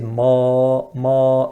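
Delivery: ma with a full upward sound; heavy wrong ma. Shown as a demonstration. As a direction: incorrect heavy ma. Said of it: "that's sound going upwards".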